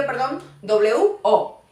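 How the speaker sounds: normal talking voice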